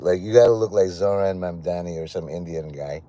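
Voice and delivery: usual speaking voice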